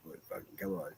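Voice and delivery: barely voiced exasperated aside; under-breath mutter. Real, not acted. Streamer grumbling to himself about chat, a voiceless mutter. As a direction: mutter it to yourself, giving up.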